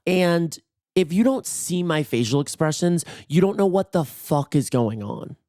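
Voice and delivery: usual speaking voice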